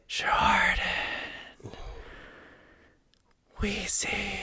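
eerie whispered ghost voice. Retold or imitated. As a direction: breathe it out like a haunting ghost. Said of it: "it would be like".

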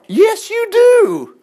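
very high, drawn-out preaching cry; loud, insistent contradiction; emphatic shout. Real, not acted. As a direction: shout it slowly to the back row. Preacher loudly contradicts the doubt.

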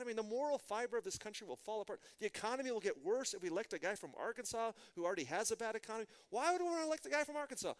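agitated, pitch climbing to a high loud finish; worked-up mock outrage; retold indignation. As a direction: replay an indignant rant, building to an exasperated question. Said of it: "I was angry about it".

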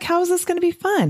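high, quick incredulous question; the doubting brain pushes back; skeptical brain. Pretend. The doubting brain answers the doctor, high and incredulous.

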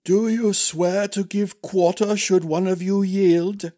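high, ceremonial questioning; formal old master-at-arms. Acted. Master-at-arms putting the oath to princes, high and formal. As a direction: intone the oath solemnly in an aged voice.

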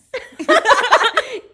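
high, loud cackle of amusement; real delighted shriek of laughter; loud burst of laughter. Real, not acted. Bursts out laughing about Karens.

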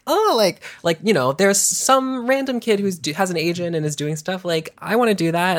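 put-on high, pleading kid voice; whiny little brat. Kid begging parents for an agent, mock whiny. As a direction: imitate your younger self whining for a turn. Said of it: "like, a whiny little brat".

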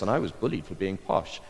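ordinary talk, nothing marked

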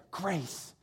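one soft, low word; quiet, merciful answer; gentle pronouncement. Retold or imitated. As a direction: drop to a hush after the fervour. Jesus' quiet answer after loud preaching.

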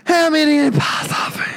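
high, strained, airless cry; gasping astronaut. Pretend. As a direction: choke it out with no oxygen. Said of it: "on Mars telling people".

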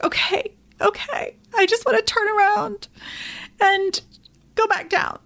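high, whimpering imitation of giving up; tearful retelling. Daughter to her mom on the mountain, mock-crying. Retold sobbing reply on the hike.

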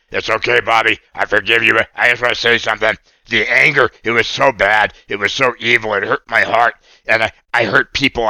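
lightly voiced puppet boy, earnest and sorry; a hint of a cartoon puppet confessing; Oscar puppet voice. Puppet Oscar confesses his anger.

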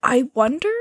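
ordinary delivery

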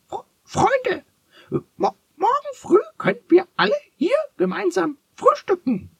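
very high, fast stuttering chicken voice; clucking hen. Acted. The brown hen inviting all friends to breakfast, high clucking. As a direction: cackle it out like an excited hen.

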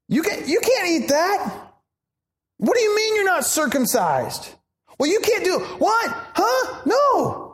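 a hint of a high, shocked scold; mock scolding legalist. Visitors correcting new Christians, lightly mocking high scold. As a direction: scold them like a rule-keeper in disbelief. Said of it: "they start saying".

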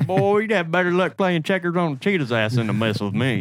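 Southern grandpa voice. Retold or imitated. Imitating Southern grandpa sayings.

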